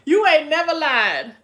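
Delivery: You ain't never lied, called out loud; emphatic agreement. Real, not acted. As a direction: shout out the agreement, laughing still.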